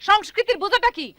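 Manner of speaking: loud, high teacher's outburst; angry scolding. Acted. Teacher halts a quarrelling gang.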